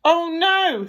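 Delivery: high, loud exasperated groan; fed-up Mr Bear. Acted. Mr Bear kept awake by snoring, high exasperated cry. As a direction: wail it, at the end of your tether.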